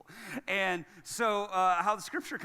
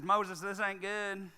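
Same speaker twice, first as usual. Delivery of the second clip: put-on very southern accent; 2 semitones higher, 4 dB louder than usual. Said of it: "I'm sure in a very southern accent".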